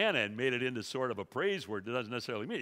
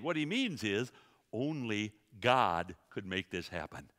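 ordinary delivery

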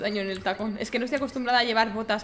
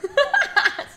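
loud laugh; 7 dB louder than usual. Real, not acted. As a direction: burst of high laughter. Laughing at the name mix-up.

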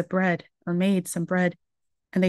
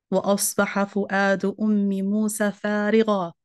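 Quran recitation; faster than usual. Acted. Quran verse about Umm Musa.

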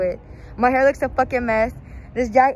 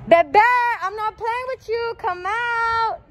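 much higher coaxing call; high-pitched come-out call; 11 semitones higher, much slower than usual. Acted. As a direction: coax her out with a high call. Calling her to come out.

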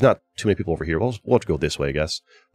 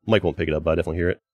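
usual speaking voice

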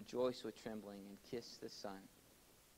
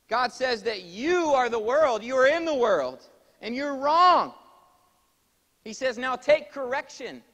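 high, very loud rebuke from the pulpit; full-force preaching; 13 semitones higher, 18 dB louder than usual. Real, not acted. Preacher telling the world it is wrong, loud forceful rebuke. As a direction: drive the correction home at full volume.

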